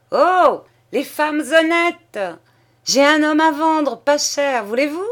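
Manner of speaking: high, loud jeering call across the water; rowdy boatwoman hollering a cheeky offer; mocking shout. A narrator's reading. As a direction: bawl it out to the bank, teasing and brazen. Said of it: "Une d'elles cria vers la berge".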